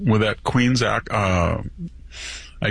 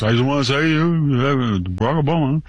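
lightly mocking politician's put-on voice; a hint of a pandering candidate working the crowd; Barack Obama; 3 semitones higher, faster than usual. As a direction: mildly mimic a politician code-switching for the audience.

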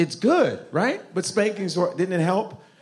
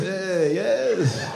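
mock Middle Eastern accent; 6 semitones higher, slower than usual. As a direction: playfully imitate the audience member's menace. Mocking Middle Eastern audience member.

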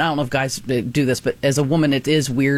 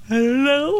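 high, teary "I don't know"; helpless high-pitched answer while weeping; crying I don't know; 11 semitones higher than usual. Retold or imitated. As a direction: squeak it out through tears. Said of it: "and you go".

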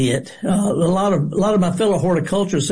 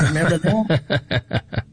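laughing; 2 semitones lower, much slower than usual. Real, not acted. Laughing while recalling Pirate Day.

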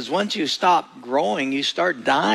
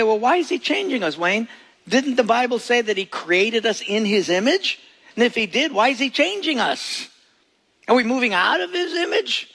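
higher, questioning doubter; skeptical listener; 6 semitones higher than usual. Retold or imitated. Congregant questioning the preacher, higher and puzzled. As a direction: push back like a puzzled skeptic. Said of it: "you say".

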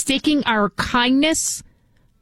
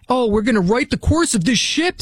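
mocking quote; 2 semitones higher than usual. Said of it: "Sonny Hostin said".

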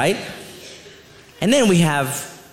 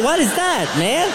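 normal talking voice

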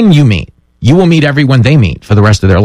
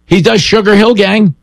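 excited; 9 semitones higher than usual. Real, not acted. Excited about Baier rapping.

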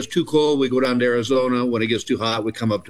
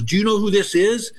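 higher, incredulous fan question; retold eager question, pitched up; starstruck older lady; 7 semitones higher than usual. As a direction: imitate a fan quizzing her coworker. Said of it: "and she goes".

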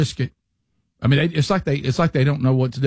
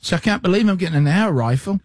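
light mock British voice, pitched up; mock British accent; 5 semitones higher than usual. Host repeats the shopper's line in a mock British accent. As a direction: play the amazed shopper again. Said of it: "British guy in Walmart".

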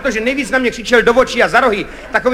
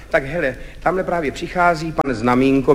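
low, subdued director voice; lightly voiced director, low and matter-of-fact; Kachňa; 8 semitones lower, 6 dB quieter than usual. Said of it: "přišel režisér Kachňa a povídá". (hluboký, tlumený hlas režiséra)

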